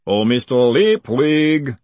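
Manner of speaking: big, slow, drawn-out boom. A narrator's reading. Golem hailing a fugitive across the stable yard, booming. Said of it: "the voice boomed out".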